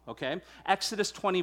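ordinary talk

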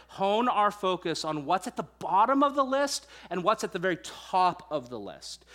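ordinary talk

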